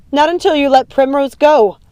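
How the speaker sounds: high, loud refusal; defiant shout. A narrator's reading. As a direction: yell back and stand your ground. Daughter shouts defiance back.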